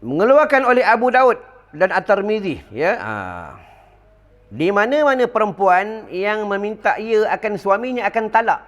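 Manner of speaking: preacher reciting a hadith to the congregation; hadith recitation. Acted. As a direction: proclaim the text solemnly. Reads hadith translation.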